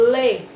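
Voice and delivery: "lê" punched loud on its own; strong syllable. Shown as a demonstration. Teacher isolating the tonic syllable, a loud stressed "lê". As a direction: hit "lê" as a lone syllable. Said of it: "a sílaba tônica".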